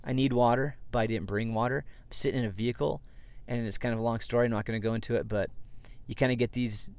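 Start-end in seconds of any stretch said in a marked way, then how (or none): none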